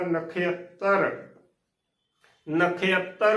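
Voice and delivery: ਨਖੇਅੱਤਰ said na-khe-attar, e glide after ਖ; correct pronunciation. Shown as a demonstration. Teacher models for learners, ਨਖੇਅੱਤਰ as na-khe-attar. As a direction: open ਖ into e-a in ਨਖੇਅੱਤਰ. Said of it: "ਇਸ ਦਾ ਸ਼ੁੱਧ ਉਚਾਰਨ".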